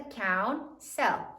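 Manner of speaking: "count. Cell." as two separate syllables of counsel. Shown as a demonstration. Teacher readies class to write counsel, syllable by syllable. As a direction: pause between "count" and "Cell". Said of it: "Now say it as you write it".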